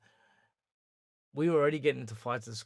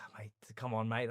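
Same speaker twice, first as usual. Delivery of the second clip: whispered groan of disbelief; exasperated whisper; 3 dB quieter than usual. Retold or imitated. Trainer pleading with the boy over his robe, whispered. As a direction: breathe it out, fed up.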